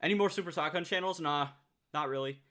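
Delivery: usual speaking voice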